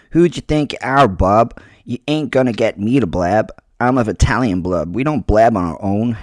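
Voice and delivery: a tough Jersey thug refusing to talk; New Jersey gangster accent. Acted. New Jersey gangster accent, defiant at his captor. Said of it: "I'm going to attempt an accent".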